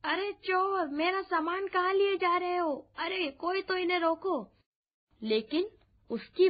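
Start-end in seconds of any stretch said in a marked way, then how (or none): 0.0-4.5 high-pitched narrated yell after a thief; crying out. A narrator's reading. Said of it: "वह जोर से चिल्लाते हुए बोला". (ऊँचे सुर में चिल्लाकर पुकारना)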